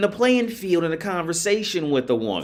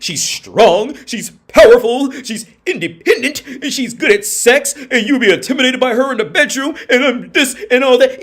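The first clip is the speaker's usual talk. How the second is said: a hint of a mocking, boastful woman, louder with laughs; mocking her boasts; 5 semitones higher, 5 dB louder than usual. Mimicking a self-praising woman to his listeners, mocking and laughing. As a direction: parrot her bragging with a laugh.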